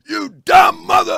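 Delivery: high, loud shout, imitating dad's outburst; loud cussing father. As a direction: yell it like an exasperated father. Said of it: "my father is real loud".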